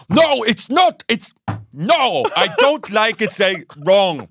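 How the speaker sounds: high, loud flustered scolding; mock angry dad. Dad too angry for English, high loud sputter.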